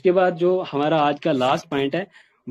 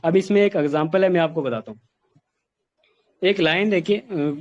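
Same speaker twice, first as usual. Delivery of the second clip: ordinary talk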